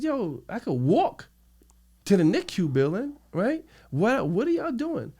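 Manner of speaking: high, exasperated re-enacted argument; indignant protest. Re-enacts arguing with the officers.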